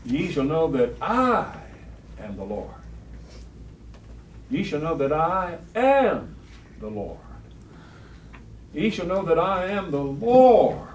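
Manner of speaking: slow, weighty repetition of the Lord's words; emphatic repeated reading. Shown as a demonstration. Teacher stressing God's words to the class, slow and emphatic. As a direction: drive home each line slowly. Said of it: "Just think of the emphasis".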